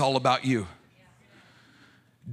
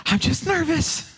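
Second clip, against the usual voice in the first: nervous; 11 semitones higher than usual. Retold or imitated. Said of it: "She goes".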